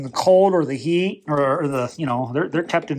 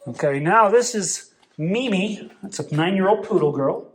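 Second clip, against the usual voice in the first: ordinary delivery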